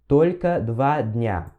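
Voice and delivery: very slow, careful phrase; slow demo. Shown as a demonstration. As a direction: draw out each word. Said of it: "E aí ele fala assim". (fala bem devagar)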